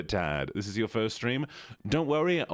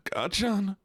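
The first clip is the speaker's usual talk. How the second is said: high plaintive anime hero impression; Deku; 11 semitones higher than usual. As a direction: cry out the name in a high put-on voice. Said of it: "You sound like Deku".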